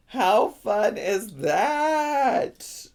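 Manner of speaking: very slow, high singsong gush. Real, not acted. Streamer gushing over the belt bag, high singsong. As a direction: stretch the last word way out, up high. Repeats it higher, more drawn out.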